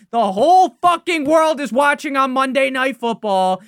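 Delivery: loud, high furious rant; angry rant. Real, not acted. Fan raging about the blown lead, loud and angry. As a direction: vent your fury at the team. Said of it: "I'm sorry for yelling".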